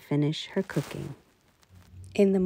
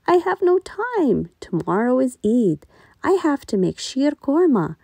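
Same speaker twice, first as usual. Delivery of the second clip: lightly voiced mother, too busy; 6 semitones higher, 3 dB louder than usual. A narrator's reading.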